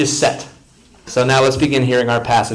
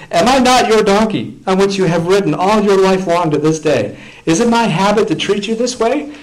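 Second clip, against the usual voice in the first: much higher, louder, hurt donkey's cry; pleading donkey; 7 semitones higher, 4 dB louder than usual. Acted. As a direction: give the wounded donkey a voice. Donkey answers Balaam.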